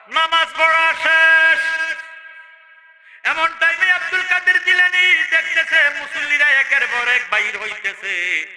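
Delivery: high, loud chanted sermon delivery; full-force preaching. Acted. Preacher telling the crowd the prayer is over, high chanted preaching.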